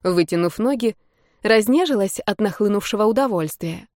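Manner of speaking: plain reading voice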